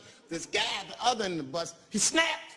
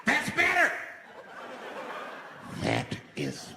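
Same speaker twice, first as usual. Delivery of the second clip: satisfied menace; 7 semitones higher, slower than usual. Retold or imitated. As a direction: savour the control.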